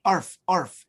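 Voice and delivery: a light, playful arf arf; mock dog bark. Shown as a demonstration. Teacher showing the student how to bark like a dog. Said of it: "So you should say".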